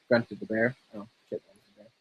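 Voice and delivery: plain reading voice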